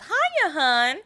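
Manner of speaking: high, loud brassy housewife chirp; fifties housewife voice. Shown as a demonstration. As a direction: greet like a gum-smacking floozy. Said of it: "I wish I could sound like a nineteen fifties housewife".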